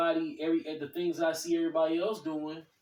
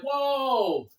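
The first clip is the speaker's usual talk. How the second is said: high, loud drawn-out whoa; long falling exclamation of alarm; shocked whoa; 8 semitones higher, 5 dB louder than usual. Retold or imitated. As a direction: imitate a wife taken aback. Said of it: "My wife was more like".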